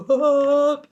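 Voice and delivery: sudden high loud ending; real emotional outburst; loud burst. Real, not acted. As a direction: blurt it out. Punchline after low build.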